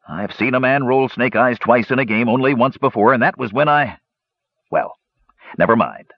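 a hint of a sheepish dwarf gambler; rambling dwarf gambler. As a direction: ramble on, then drop it.

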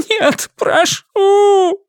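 high, slow sobbing plea. Acted. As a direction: sob the words out. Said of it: "прорыдал Гаэтан".

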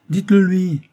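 high, loud cried-out dare; defiant cry. A narrator's reading. Rose defies the doctor's threat to tell her husband, crying out. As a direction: cry it out straight at him. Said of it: "cria Rose en le regardant".

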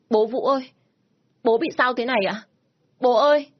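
put-on high, loud child's voice wailing for his father; a small boy calling his dad through tears; crying little boy. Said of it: "nên cứ khóc bù lu bù loa lên". (giọng bé trai cao, gào khóc gọi bố)

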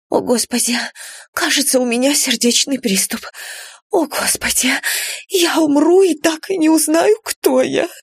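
clutching her chest in panic; having a heart attack. A narrator's reading. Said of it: "Сьюки вдруг задохнулась и схватилась за грудь".

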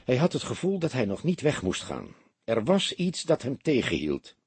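ordinary delivery, nothing marked

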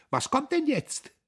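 very high, loud giggly question; choking with laughter. A narrator's reading. Girl teases the man she wrestles, choking with laughter. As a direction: squeal it as if helpless with laughter. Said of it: "vor Lachen erstickend".

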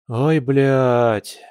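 ordinary delivery, nothing marked

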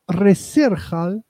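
Reserhal read as written, slowly; slow Spanish-style reading of Reserhal; spelled-out mispronunciation. Shown as a demonstration. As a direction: sound out Reserhal letter by letter, slowly. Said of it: "se pronuncia rehearsal".

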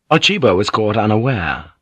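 ordinary delivery

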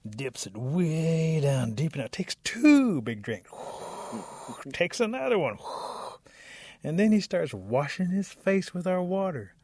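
loud, high, drawn-out emphasis; mounting outrage. Acted. Raccoon reports Rabbit's theft to the animals, mounting outrage. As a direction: build each outrage bigger, stretching the key words.

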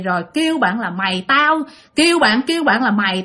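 plain reading voice

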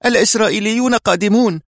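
high, urgent alarmed cry; high-pitched excited alarm; agitated warning. A narrator's reading. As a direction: burst out with breaking news of danger. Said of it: "في انفعال وهو يلهث بشدة".

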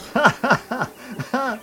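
mocking laugh. Blaireau mocks the judge's word.

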